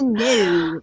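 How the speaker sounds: "no" with a flat, drawn-out Australian vowel; attempted Australian "no"; Aussie flat no. Shown as a demonstration. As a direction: draw out "no" as Australians do. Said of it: "It's that flat no".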